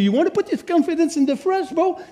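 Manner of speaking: quick, high, teasing bro talk; a hint of a buddy calling you out; Paul teasing a proud listener over coffee, quick and high; casual Paul challenge.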